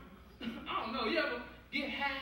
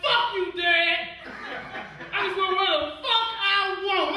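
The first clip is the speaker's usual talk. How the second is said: put-on high, loud bratty kid shout; mock-furious fish kid; defiant yell; 6 semitones higher, 8 dB louder than usual. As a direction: yell back at dad like a rebel teen. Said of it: "He's like".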